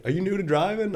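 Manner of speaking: high-pitched needling question; mock incredulous local. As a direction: imitate a local quizzing a rookie. Local quizzing new driver, retold.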